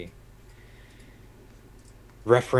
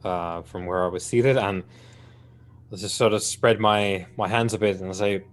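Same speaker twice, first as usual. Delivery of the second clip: usual speaking voice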